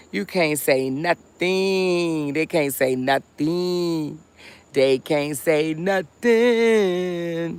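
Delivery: x0.5 gloating singsong; slow taunt. Real, not acted. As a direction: stretch it out slowly, teasing the bots. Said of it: "drawn-out taunt at the bots".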